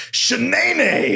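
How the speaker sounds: high-pitched mock curse; substitute swear. Shown as a demonstration. Showing friends homemade replacement curses, mock exclamation. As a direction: exclaim a silly swear, voice leaping up. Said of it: "I started using stuff like".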